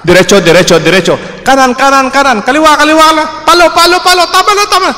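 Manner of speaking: high, loud overlapping crowd calls; shouted directions. Retold or imitated. Cousins yelling at the blindfolded child, high loud calls. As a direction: yell confusing directions at the blindfolded kid.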